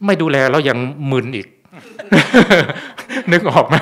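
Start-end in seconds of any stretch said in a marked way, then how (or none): 1.7-3.3 hearty laugh. Real, not acted. Monk laughs at muddled patient.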